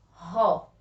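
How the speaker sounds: isolated loud syllable "Ró", throaty R; strong R. Shown as a demonstration. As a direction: push out "Ró" with a breathed h-like R. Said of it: "Pronúncia forte".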